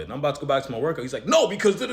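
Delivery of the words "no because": high, loud cut-in; pushy interruption. Retold or imitated. The gym guy stopping the speaker from leaving, high and loud. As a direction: grab him back with a sharp high no. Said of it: "he's trying to hook me back".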